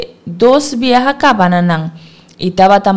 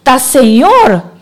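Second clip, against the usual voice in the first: slow, loud cry of recognition; loud, drawn-out shout to Pedro; excited shout; 5 semitones higher, 5 dB louder, slower than usual. A narrator's reading. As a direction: call out to Pedro in joy. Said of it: "a grita avisa Pedro".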